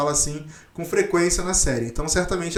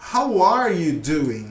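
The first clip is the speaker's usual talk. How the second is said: slow full form; much slower than usual. Shown as a demonstration. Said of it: "que significa".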